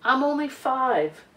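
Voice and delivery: ordinary delivery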